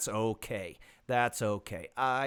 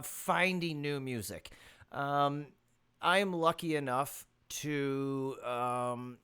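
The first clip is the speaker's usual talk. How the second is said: usual speaking voice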